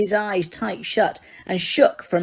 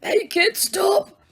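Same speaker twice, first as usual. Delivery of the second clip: high, slowing moan of a plea; 9 semitones higher, slower than usual. Acted. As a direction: moan it out, fading. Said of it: "moaned Dumbledore".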